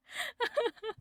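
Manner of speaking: high-pitched squeal of laughter; real squealy laugh. Real, not acted. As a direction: burst into a shrill giggle.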